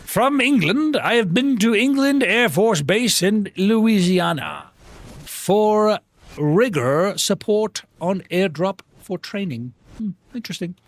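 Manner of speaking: slow, grandly stretched theatrical voice; Matt Berry. Shown as a demonstration. Streamer reads out a chat message, Matt Berry voice. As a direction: start high, linger pompously on each phrase. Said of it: "doing my Matt Berry impression".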